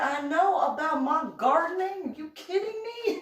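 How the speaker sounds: incredulous outrage. Retold or imitated. Retold outrage with husband.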